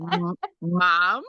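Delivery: hesitant, questioning imitation of a child; confused child. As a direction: stammer it like an unsure little kid. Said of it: "he looked at me confused".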